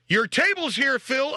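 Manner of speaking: high, loud cheery delivery call; delivery person. Pretend. Caterer calls out to the dead host, high and loud. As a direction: sing out the delivery brightly. Imagined caterer at his wake.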